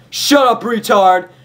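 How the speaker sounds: high, loud barked insult; angry yell. Acted. Guards screaming at Derpy on the ground, loud furious yell. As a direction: scream it down at her with contempt. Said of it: "kicked her sides and yelled".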